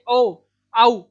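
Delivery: ओ, आउ voiced from throat and lips, cleanly separated; vowel demo. Shown as a demonstration. Teacher voices ओ and आउ to show their place of articulation. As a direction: round the lips on ओ and glide through आउ. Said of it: "जब हम बोलते हैं".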